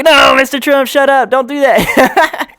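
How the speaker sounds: high, loud pleading voice; pleading aide. Pretend. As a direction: beg him to stop, slowing at the end. Voicing an aide scolding Trump.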